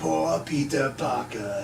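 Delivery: slow, hissing reptile voice; cold hissing lizard voice. Retold or imitated. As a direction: drawl it out like a lizard villain. Echoes the lizard line in voice.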